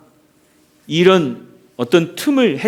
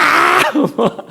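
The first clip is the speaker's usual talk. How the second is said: high falling laugh; 6 semitones higher than usual. Real, not acted. Preacher laughs at his own coach impression, falling laugh.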